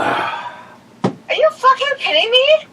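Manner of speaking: high, drawn-out outraged disbelief. Real, not acted. Daughter confronting dad over an ignored call, outraged. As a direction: stretch it out high, really incensed.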